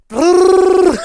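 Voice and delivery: a sudden high, loud burst, collapsing into a laugh; mock angry brr. Streamer attempting the angry brr for chat, high loud burst. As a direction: belt it out in fake rage. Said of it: "I sound so stupid".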